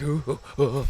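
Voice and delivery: soft, breathy mock moans of pleasure; hammed-up moaning at each bump.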